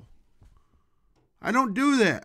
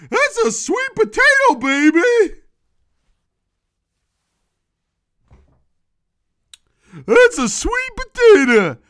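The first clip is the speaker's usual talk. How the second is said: much higher and louder squeaky put-on voice; goofy falsetto cartoon voice; silly high voice; 12 semitones higher, 7 dB louder than usual. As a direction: jump up high for the punchline. Silly punchline voice about sweet potato.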